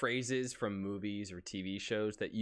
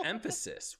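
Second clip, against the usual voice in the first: normal talking voice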